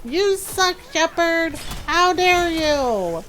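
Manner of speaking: high-pitched, drawn-out mock accusation; mock indignation. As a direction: imitate a crowd of offended friends. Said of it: "mad at Shepard".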